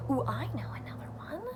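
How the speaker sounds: quick hushed ooh; excited whisper. Acted. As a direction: whisper it fast like a secret idea. Teacher whispers idea.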